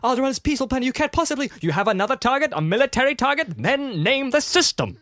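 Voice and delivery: high-pitched, frantic protest; pleading female character. Acted. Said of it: "And she's like".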